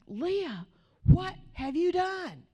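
high, drawn-out scolding cry; aghast mother. Retold or imitated. Mother finds marker all over the house, aghast scolding. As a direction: imitate a horrified mom, pitch leaping up and stretched. Mom voiced discovering the marker mess.

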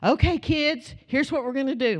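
put-on rally the kids; lightly voiced pastor. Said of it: "he goes".

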